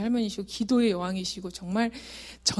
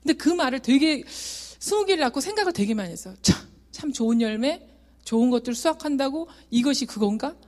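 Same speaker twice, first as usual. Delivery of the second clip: ordinary talk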